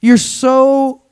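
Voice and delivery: lively preacher cadence; preaching. Acted. Pastor preaching to his church, rousing. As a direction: preach it with rising energy to the congregation.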